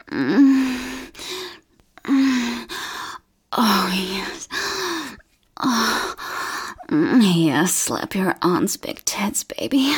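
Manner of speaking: ordinary talk, nothing marked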